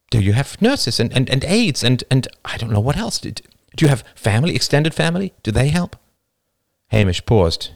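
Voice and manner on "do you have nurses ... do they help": higher, earnest, stammering questioner; Jane, concerned and fumbling. Acted. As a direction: grope for helpful questions, tripping over "and".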